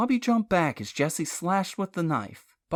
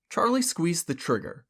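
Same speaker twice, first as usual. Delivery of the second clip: usual speaking voice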